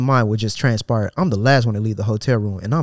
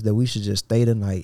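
ordinary talk, nothing marked

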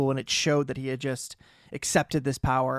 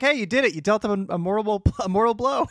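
excited Merlin; 6 semitones higher than usual. Retold or imitated. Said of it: "Merlin's like".

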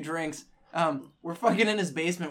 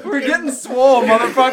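louder, pumped-up gym bro yell; loud bro shout; 5 semitones higher, 9 dB louder than usual. Pretend.

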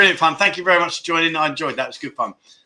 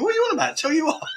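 retold bafflement in a high voice; very high, laughing imitation of his own confusion; baffled; 11 semitones higher, 5 dB quieter, much faster than usual. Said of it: "I'm thinking".

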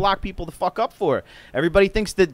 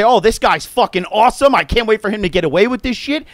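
loud sarcastic praise; mock Trump praise; 4 semitones higher, 6 dB louder than usual. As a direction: gush mockingly. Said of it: "He can say".

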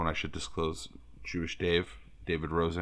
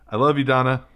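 loud, higher mock devotion; hammed-up gushing; 6 semitones higher, 7 dB louder than usual. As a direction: gush it out, over the top. Joking declaration into voicemail.

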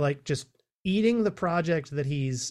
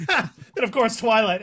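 laughing; 6 semitones higher, 9 dB louder than usual. Real, not acted. Teasing S1 for loving Twilight.

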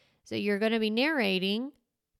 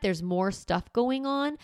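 ordinary delivery

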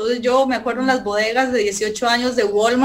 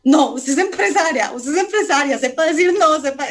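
lightly voiced insistent father, a bit higher; a hint of Dad urging her on; father's voice in her head; 4 semitones higher than usual. Woman retelling her father's pep talk, lightly insistent father. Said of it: "la voz a veces como de mi papá".

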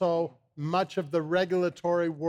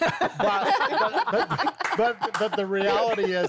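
laughing through the words, pitch jumping up; 4 semitones higher than usual. Real, not acted. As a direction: chuckle while pushing the point. Laughing over bragging men joke.

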